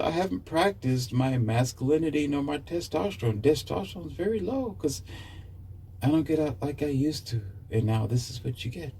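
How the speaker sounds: high, soft confessing mock voice; soft-voiced man. The softened man explaining himself to listeners. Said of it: "now I talk like this".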